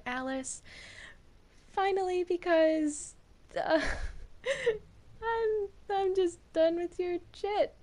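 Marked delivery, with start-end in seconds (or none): 4.6-7.7 high laughing fed-up villain. Acted. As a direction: laugh through the line.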